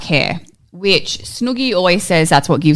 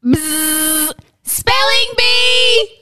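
game show announcer; 14 semitones higher, much slower than usual. Acted. Spelling bee segment jingle.

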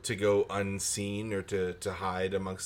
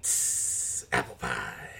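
much lower, a hissed lead-in, then apple pie; lower mock echo; 11 semitones lower, slower than usual. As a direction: drop low and savour it. Echoing menu, low.

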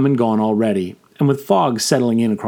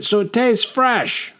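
bellowed over the shoulder, drawn out slowly; 12 semitones higher, slower than usual. A narrator's reading. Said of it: "He bellowed out this last bit".

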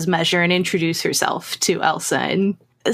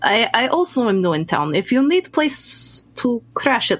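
put-on courteous Russian-accented newcomer. Newcomer offering Maria shelter, respectful Russian accent. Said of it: "German with a Russian accent".

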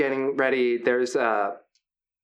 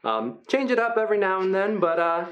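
a lightly higher, friendly voice for a huge gentle creature; friendly hulking Brutus; 3 semitones higher than usual. Acted. Big gentle wrestler Brutus welcoming the new fighters, upbeat. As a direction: play the big guy as sweet and encouraging.

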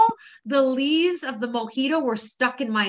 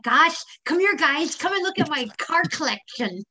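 with braces; 6 semitones higher than usual. Retold or imitated. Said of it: "I did that with my mom, too. She had this retainer".

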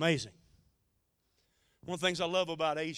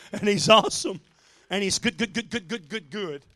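excited; 3 semitones higher, 11 dB louder than usual. Real, not acted. Preacher exults over God.

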